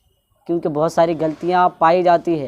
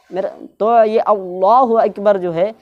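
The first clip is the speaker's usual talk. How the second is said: usual speaking voice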